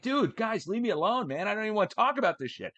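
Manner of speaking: high, fast exasperated whine; exasperated whiny self-quote. Retold or imitated. To the weather service, a high fast fed-up whine. Mocking own exasperated reply.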